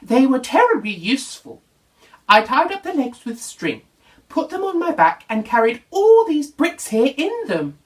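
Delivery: chatty then sorry; Zebra, lightly voiced.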